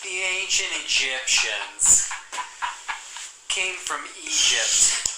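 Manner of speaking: slow, drawn-out lecturing; history teacher voice. Retold or imitated. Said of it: "Sound like every history teacher ever".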